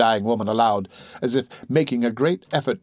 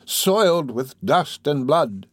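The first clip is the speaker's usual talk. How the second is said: put-on slow, higher-pitched old woman voice; sick old woman recalling; 4 semitones higher, slower than usual. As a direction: drag the words out as if searching your memory. Dying old woman recounting her memory.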